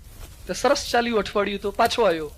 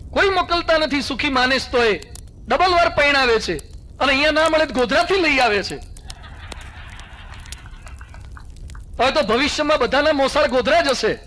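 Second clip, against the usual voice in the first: raised, ringing sermon voice to the crowd; forceful preaching; 5 semitones higher than usual. Acted. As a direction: drive the lesson home like a preacher. Preaching to the villagers. (જોશીલું પ્રવચન)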